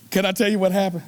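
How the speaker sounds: ordinary talk, nothing marked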